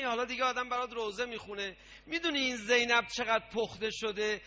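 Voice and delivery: normal talking voice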